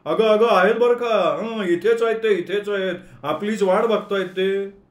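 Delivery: high, loud call across the platform; calling out loudly. Acted. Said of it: "मोठ्यानं हाक मारत म्हणाला".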